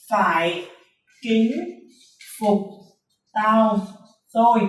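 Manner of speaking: mnemonic spoken one word at a time, pauses between. Shown as a demonstration. Teacher giving students rule number one, word by word with pauses. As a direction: space out each word of "Phải kính phục tao thôi". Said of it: "Chúng ta có nguyên tắc số một".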